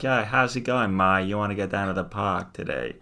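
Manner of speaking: Boston accent. Shown as a demonstration. Showing his co-host the Boston accent he researched. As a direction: lean into the open Boston A sounds. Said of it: "Boston's a bit more about the A".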